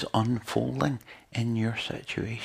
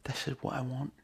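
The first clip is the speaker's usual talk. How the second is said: whispered; 8 dB quieter than usual. Retold or imitated. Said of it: "he's gonna be like".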